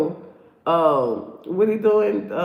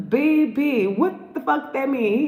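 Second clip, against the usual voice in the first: incredulous; 7 semitones higher, slower than usual. Real, not acted. Incredulous reaction to clip.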